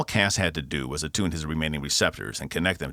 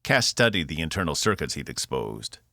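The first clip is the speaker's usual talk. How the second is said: ordinary delivery, nothing marked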